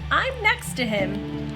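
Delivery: usual speaking voice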